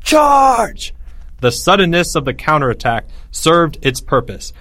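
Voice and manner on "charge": a much higher, louder battle cry; screamed battle cry. A narrator's reading. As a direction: send the soldiers charging forward. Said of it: "he screamed suddenly".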